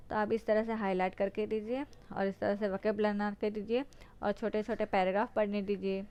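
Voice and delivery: usual speaking voice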